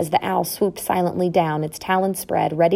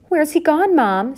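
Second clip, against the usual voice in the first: put-on high, piping child's question; puzzled child; 10 semitones higher, 3 dB louder than usual. A narrator's reading. Young mouse asks where father went, puzzled. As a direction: ask it high like a small child.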